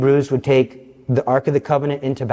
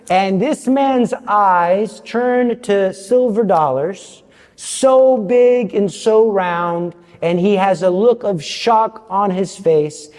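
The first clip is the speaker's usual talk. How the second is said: slow dramatic telling; 6 semitones higher, much slower than usual. A narrator's reading. Dramatic storytelling of the robber's shock.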